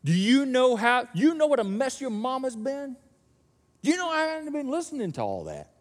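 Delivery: nagging mom scolding the returning son; scolding parent. Acted. Scolding parent voiced in story.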